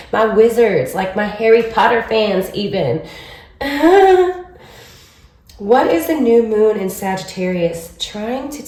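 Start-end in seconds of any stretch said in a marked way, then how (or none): 3.6-4.4 a high burst of laughter; bright laugh. Real, not acted. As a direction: laugh out at your own joke. Laughs after Harry Potter joke.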